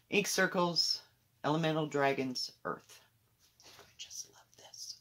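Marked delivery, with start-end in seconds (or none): 3.7-5.0 whispered gushing; adoring whisper. Real, not acted. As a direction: whisper it like you're smitten. Gushing in a hushed whisper over favourite project.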